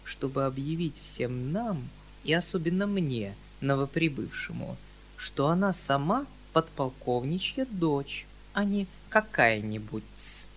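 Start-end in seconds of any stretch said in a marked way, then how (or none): none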